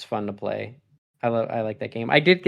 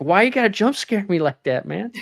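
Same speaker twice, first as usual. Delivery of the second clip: mock indignant complaint; 8 semitones higher than usual. Complaining about jump scare.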